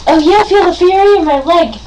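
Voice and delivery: loud, high gloating taunt; mock battle cry. Girl playing dolls gloats over her rival, loud mock taunt. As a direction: crow over the win in a pretend fight.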